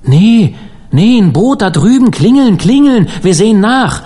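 loud, high singsong shouts; urgent calling. A narrator's reading. Dan orders the bell rung after a collision, urgent high shout. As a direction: call out orders over the water, high and loud.

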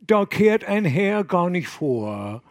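gruff coastal fisherman, drawn out; fisherman dialect. Acted. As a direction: wave the gentleman off in dialect. Fishermen brushing off the abbot.

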